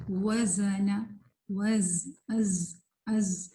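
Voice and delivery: slow, drawn-out buzzing z in وزن and از; demonstrated articulation. Shown as a demonstration. Teacher showing students example words, slow buzzing ز in از. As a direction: hold the ز of وز and از as a long whistling buzz. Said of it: "مثالیں دیکھتے ہیں".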